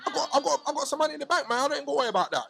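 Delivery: high, lively boast about money in the bank; excited. Real, not acted. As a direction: crow about having money, voice up.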